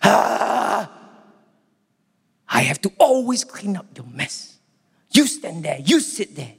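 a hint of an annoyed shepherd; imagined shepherd complaining about cleaning up the mess; grumbling shepherd. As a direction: sigh and grumble like a put-upon shepherd. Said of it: "doesn't go".

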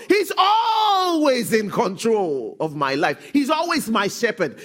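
loud, high, slow declaration; fervent preaching proclamation; full-force preaching. Acted. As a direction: proclaim it to the church.

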